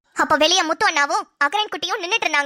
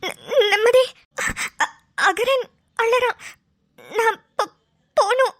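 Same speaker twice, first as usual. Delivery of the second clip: halting tearful plea; slower than usual. Acted. Leaves in tears, pleading.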